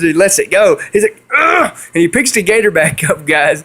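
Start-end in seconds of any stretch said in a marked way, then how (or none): 1.4-1.8 high-pitched strained "ugh"; startled grunt. Retold or imitated. Friend lets the turtle go, startled high grunt. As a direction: imitate a friend's squeal-like grunt.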